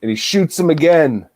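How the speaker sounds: ordinary talk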